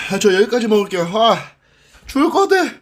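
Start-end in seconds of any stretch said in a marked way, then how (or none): 1.2-2.7 high strained wail from the burn; mouth burning from spicy food. Real, not acted. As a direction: squeak it out in pain. (매워서 죽겠다는 높은 비명)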